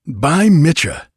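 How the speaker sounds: loud, high, drawn-out oath; astonished exclamation. A narrator's reading. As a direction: cry out in amazement, slowly. Startled rider's exclamation in story.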